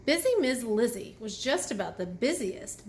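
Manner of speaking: ordinary delivery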